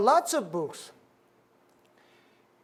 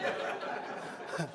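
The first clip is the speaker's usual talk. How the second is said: soft laugh; 12 dB quieter than usual. Real, not acted. Laughing at teacher's surprise.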